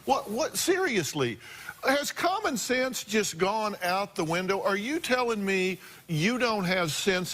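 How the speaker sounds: high, outraged scolding of the guest. Real, not acted. Host confronting the mother on stage, outraged. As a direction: demand answers in disbelief.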